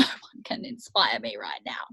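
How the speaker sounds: hushed, hopeless mock moan; despairing whisper. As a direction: whisper it like you've given up. Retold despairing inner thought.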